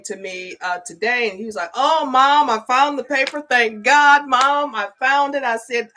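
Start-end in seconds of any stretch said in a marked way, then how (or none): 1.8-5.4 higher and louder, gushing with relief; mock-relieved teen bursting out to mom; relieved son. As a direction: blurt it out like a kid who found his lost paper.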